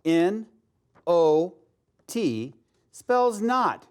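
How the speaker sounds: each letter set apart, very slowly; slowly spelling "N O T" letter by letter. Shown as a demonstration. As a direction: spell it out for the class, N... O... T. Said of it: "Let's spell not".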